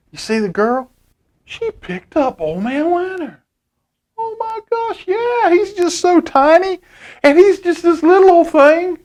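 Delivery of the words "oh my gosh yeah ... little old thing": high, tender cooing over something tiny. Real, not acted. Cooing over tiny Old Man Winter.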